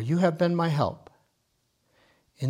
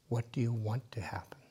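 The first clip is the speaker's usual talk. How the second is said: much softer whispered question; 3 semitones lower, 10 dB quieter than usual. Real, not acted. Asking the group their hopes, whispered. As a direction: let the question drop to a whisper. Guiding listeners in meditation.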